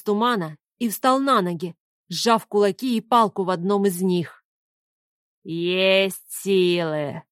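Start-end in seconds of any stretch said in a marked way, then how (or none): 5.4-7.2 put-on slow, low, pleased old man's voice; satisfied old man. A narrator's reading. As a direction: savour it like a sly old man approving. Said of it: "удовлетворённо кивнул старик".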